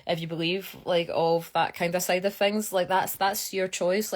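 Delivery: ordinary talk, nothing marked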